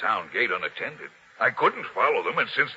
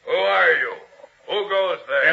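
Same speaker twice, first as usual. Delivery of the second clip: higher, louder challenge into the dark; halting riders at the gate, loud challenge; sentry's challenge; 9 semitones higher, 7 dB louder than usual. Acted. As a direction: call out to the riders.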